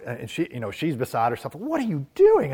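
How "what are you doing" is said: high, louder imitated scolding mother; shocked mom demanding an answer, pitched way up; upset mother. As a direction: play the mother who is beside herself. Mother beside herself, scolding son.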